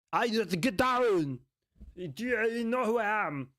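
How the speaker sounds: hammed-up Scottish tough guy; attempted Scottish accent. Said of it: "maybe I could do a Scottish accent".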